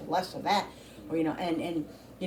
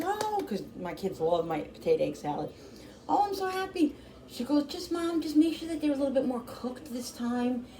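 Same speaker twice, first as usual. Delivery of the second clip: high, sweet imitation of her daughter; daughter's voice; 7 semitones higher than usual. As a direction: lift the voice high like the daughter asking nicely. Daughter reacts to potato salad.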